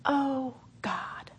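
soft breathy cry of "Oh, God"; whispered realization, almost crying; awed whisper. Retold or imitated. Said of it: "And then I realized".

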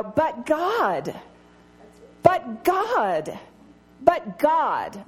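each "But God" hit hard on God, rising; emphatic refrain. Acted. Preacher to women, hammering home "But God". Preacher repeating key phrase.

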